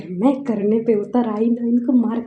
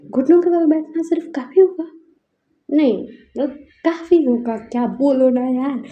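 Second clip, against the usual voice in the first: higher, louder scornful challenge; indignant retort; 4 semitones higher, 5 dB louder than usual. Retold or imitated. Wife scorning the kneeling rich women, indignant. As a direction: demand more than kneeling, up high. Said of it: "फिर मैं बोलती कि".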